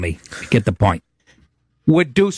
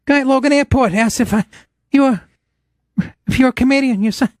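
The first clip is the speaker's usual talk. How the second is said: imitating mom; 10 semitones higher than usual. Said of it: "My mom's like".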